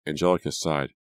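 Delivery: plain reading voice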